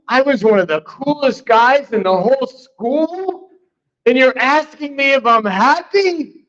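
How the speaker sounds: a hint of a cool guy's wounded pride; offended disbelief. Speaking to his old high school buddy in indignant disbelief.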